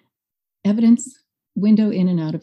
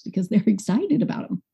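ordinary delivery

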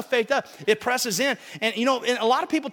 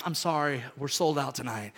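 lower, softer doorman apology; mock ticket clerk; 10 semitones lower, 6 dB quieter than usual. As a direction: play a polite clerk turning them away.

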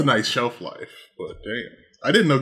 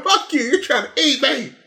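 mock retort; 11 semitones higher than usual. Acting out the tainted victim.

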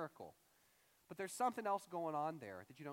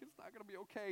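mock breaking up, voice pitched up; higher, play-acted overwhelmed wail; emotions hitting again; 4 semitones higher, 3 dB quieter, faster than usual. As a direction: crumble into an acted near-sob. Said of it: "the emotions hit you again".

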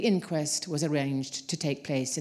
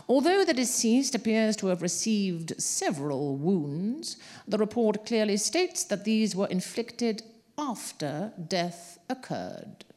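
reedy voice; 5 semitones higher than usual. A narrator's reading. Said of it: "the coroner said in a reedy voice".

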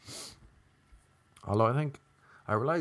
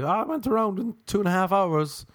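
chirpy newlywed; 9 semitones higher than usual. Acted. Newlywed golfer bragging.

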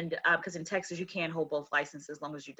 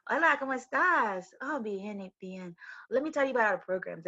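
put-on light Spanish-accented sales voice; 4 semitones higher than usual. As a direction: slip into a friendly Spanish accent on the phone. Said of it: "I would talk like this".

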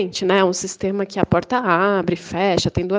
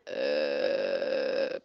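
stunned low sigh; 11 dB quieter than usual. Real, not acted.

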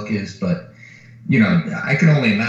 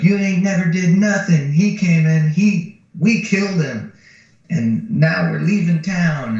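sassy scheming woman; 8 semitones higher, 5 dB louder than usual. Retold or imitated. Woman tells the sheriff her plan, loud and sassy. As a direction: push it out like a fed-up schemer.

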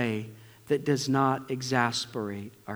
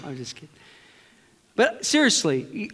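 ordinary talk

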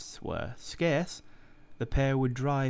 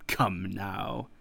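sneering; 4 semitones lower than usual. A narrator's reading. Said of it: "sneered the other".

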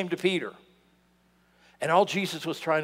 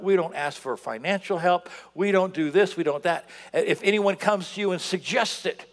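ordinary delivery

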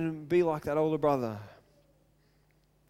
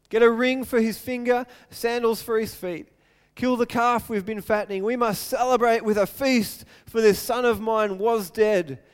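louder, higher, excited commands; joyful orders; 4 semitones higher, 6 dB louder than usual. Acted. Father ordering his servants to prepare a feast, excited and loud. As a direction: call out to the servants, overjoyed.

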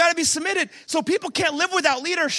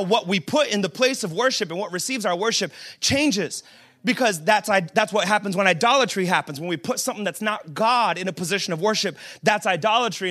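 ordinary talk, nothing marked

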